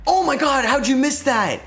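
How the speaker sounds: real shock, voice shooting up high; shocked outcry. Real, not acted. Gamer crying out as his player misses the lead shot, shocked.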